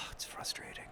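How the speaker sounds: breathy whispered frustration. Real, not acted. Gamer after a miss, frustrated whisper. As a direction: whisper it, defeated.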